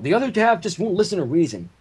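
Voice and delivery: normal talking voice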